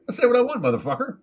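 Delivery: high, defiant parrot voice; cussing parrot. Acted. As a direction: talk back as a cocky parrot. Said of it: "He's like".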